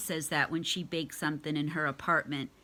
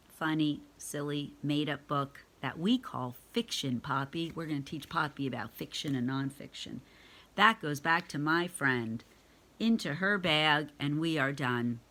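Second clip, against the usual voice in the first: ordinary delivery, nothing marked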